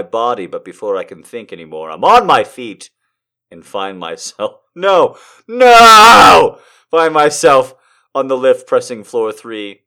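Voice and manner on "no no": loud, high, drawn-out no; protesting shout. Real, not acted. Reader objecting to the story's turn, loud high shout. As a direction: wail it out in mock horror.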